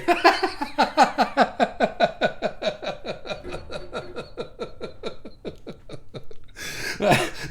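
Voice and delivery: breathy airy laughter at a joke; long laugh. Real, not acted. Streamer laughs at a viewer's joke, long airy laugh. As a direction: let a long helpless laugh roll out.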